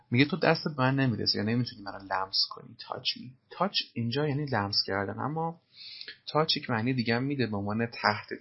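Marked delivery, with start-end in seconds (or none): none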